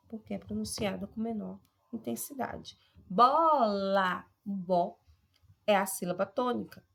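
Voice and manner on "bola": "Bola" with a loud, drawn-out BO; teacher's punched BO, lighter la in "Bola"; stress on BO. Shown as a demonstration. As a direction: hit and hold the first syllable of "Bola". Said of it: "se destaca mais do que".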